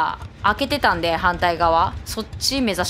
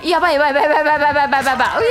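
panicked yelling; 5 semitones higher, 6 dB louder, much faster than usual. Real, not acted. Being chased, panicking.

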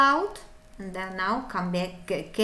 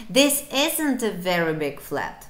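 normal talking voice

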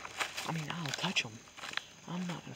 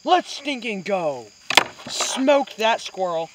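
loud, whooping celebration after a hit; triumphant shout; 5 semitones higher, 19 dB louder, much slower than usual. Real, not acted. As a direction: yell it out like you just nailed the shot.

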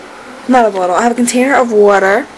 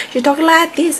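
attempted Jamaican accent, higher pitched; 7 semitones higher than usual. Vlogger mimicking a rapper, rough Jamaican accent. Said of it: "a Jamaican accent".

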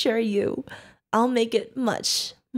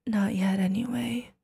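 soft, unvoiced threat trailing off; whispered sinister aside; menacing whisper; 2 semitones lower, 5 dB quieter than usual. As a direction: breathe the threat like a secret.

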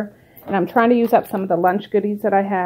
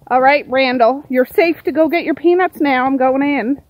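calling out; 5 semitones higher, 7 dB louder than usual. Real, not acted. Calling out to the birds.

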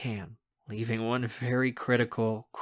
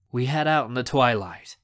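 ordinary delivery, nothing marked

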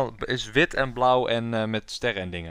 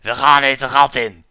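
big, booming call-out; loud hyped shout; 11 dB louder, slower than usual. Acted. Player launching into the game, shouting. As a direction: belt it out at full volume.

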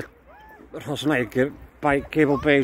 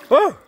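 sharp loud "Oh!" as cold water hits; startled gasp; 10 semitones higher, 6 dB louder than usual. Real, not acted. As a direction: caught by a splash. Wave splashes his face.

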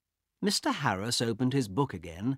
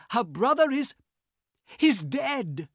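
high, loud urgent calls; panicked alarm; 13 semitones higher, 4 dB louder than usual. Acted. Harris reports the killing.

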